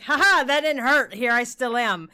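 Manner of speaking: loud, high, gloating taunt; a cocky inner critic laughing it off. Acted. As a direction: jeer like a smug gremlin in your head. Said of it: "And then she'd be like".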